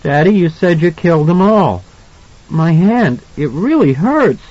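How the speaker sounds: put-on high, hurt little-boy voice; reproachful child. A narrator's reading. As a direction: plead to your dad in a high child's voice. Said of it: "looked at Jack reproachfully".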